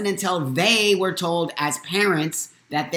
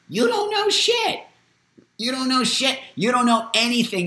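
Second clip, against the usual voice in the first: pitched-up furious retort; angry outburst; 8 semitones higher than usual. Real, not acted. Speaking out against adults who dismiss a child, angry and high. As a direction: fire it back at them, much higher.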